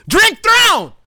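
hammed-up outraged shout; mock shout. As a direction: bark it out like throwing the drink. Imagined drink in the face.

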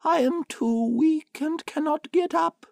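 put-on frail old woman calling out; grandmother calling. A narrator's reading. Grandmother from bed.

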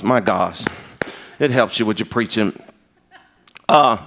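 usual speaking voice

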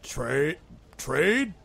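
puzzled troll. Acted. Troll puzzles over trade offer.

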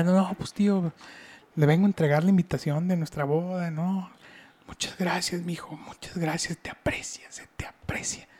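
hushed, then whispered gratitude; soft creepy uncle. Retold or imitated. Voicing the uncle thanking him. (tío agradeciendo en susurro)

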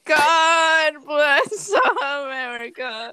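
laughing mock anthem. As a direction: drag it out while laughing. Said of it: "It's like".

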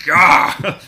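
loud frustrated shout, high; exasperated yell. Real, not acted. Ranting to friends about accents, exasperated yell. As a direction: let out a fed-up yell.

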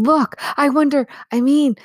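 high, hesitant and uneasy; anxious girl asking if it's safe; worried. Acted. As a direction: voice the doubt creeping in. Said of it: "said Lucy in a different tone".

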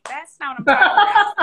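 loud, emphatic comeback; emphatic retort. Retold or imitated. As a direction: hit it hard and loud. Her retort to friends' claim.

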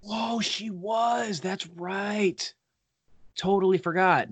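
high, slow "whoa" of real surprise; sudden happy recognition; surprised. Real, not acted. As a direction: genuinely caught off guard, drawn out.

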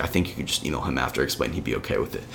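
quiet, low aside; low-key advice. Retold or imitated. Classmate advises him during the lecture, low and quiet. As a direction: drop to a soft, low, matter-of-fact murmur.

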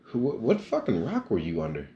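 high, stunned what the fuck; shocked retort. Retold or imitated. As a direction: blurt it out in disbelief. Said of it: "I said".

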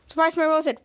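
ordinary delivery

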